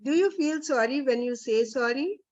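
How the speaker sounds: ordinary talk, nothing marked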